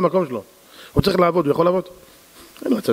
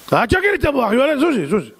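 high, angry snap at the wife; irritated husband; 7 semitones higher than usual. Acted. Husband home from work snaps at his wife to move. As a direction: push her away in a temper.

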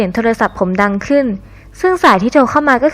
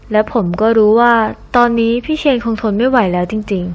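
usual speaking voice

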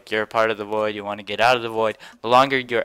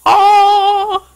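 surprised yelp; 14 dB louder than usual. Real, not acted. Sudden death in game.

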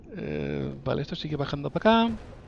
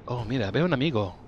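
ordinary delivery

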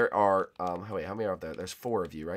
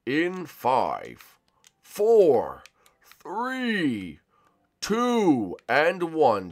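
drawn-out countdown; 5 semitones higher, 4 dB louder, much slower than usual. Acted. Countdown for seeker.

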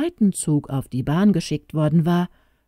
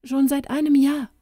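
put-on lightly raised little girl voice, sad; a young girl telling grim news, voice lifted higher; child Tona; 9 semitones higher than usual. Little girl tells the stranger her parents died, higher child voice.

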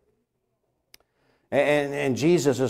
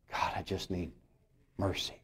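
much softer, spent whisper of a prayer; broken prayer; 6 semitones lower, 17 dB quieter than usual. Acted. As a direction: breathe it out like someone with nothing left.

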